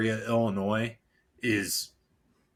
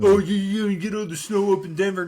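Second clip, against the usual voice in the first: higher, louder mocking imitation of naysayers; high-pitched scoffing mimicry; mock quoting; 7 semitones higher, 5 dB louder than usual. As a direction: parrot them in a raised, mocking voice. Mimicking what people told him.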